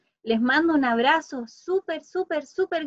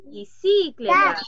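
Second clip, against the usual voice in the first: bicicleta said slowly and loud, syllable by syllable; louder slow demo; 4 semitones higher, 5 dB louder, slower than usual. Shown as a demonstration. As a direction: stretch out Bicicleta loudly so each syllable is heard. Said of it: "A ver, alguien dijo sí".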